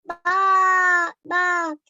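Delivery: loud "ba, ba" sheep bleat. A narrator's reading. The storyteller voices the sheep bleating outside, loud bleat. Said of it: "she heard bleating sounds".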